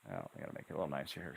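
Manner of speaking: ordinary talk, nothing marked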